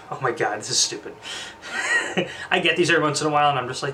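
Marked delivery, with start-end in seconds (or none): 1.2-2.4 amused laugh. Real, not acted. Scoffs at a silly affirmation card.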